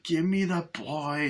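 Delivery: high, slow menacing quote; Chucky's demand. Retold or imitated. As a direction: stalk closer, dragging out the demand. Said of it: "he's like".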